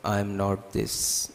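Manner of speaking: slow, low-key mantra on an even tone; measured, drawn-out affirmations with pauses; meditation affirmation. Acted. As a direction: intone each denial slowly, letting it settle. Affirmations repeated within guided meditation.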